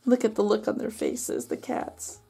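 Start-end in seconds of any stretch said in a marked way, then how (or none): none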